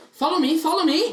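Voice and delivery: high, loud beckoning call. Acted. Gatekeeper leading travelers to the gate, calling out. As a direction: call the group along briskly and high.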